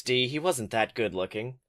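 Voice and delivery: normal talking voice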